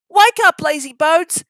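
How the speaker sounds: high, loud cheeky engine call; cheeky whistle. Acted. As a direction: shout him awake, cheeky and high. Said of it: "he whistled".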